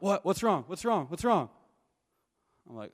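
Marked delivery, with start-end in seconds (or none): 0.0-1.5 higher and louder, frantic repeated questions; a brother panicking over the phone, pushing for answers; freaking out. Retold or imitated. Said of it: "He starts freaking out".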